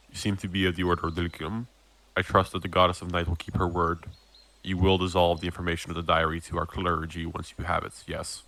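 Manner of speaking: lightly voiced low, quiet captain, brisker; solemn captain. Captain asks Glint to confirm the bargain, lightly voiced solemnity. GM repeats Captain Azar's lines.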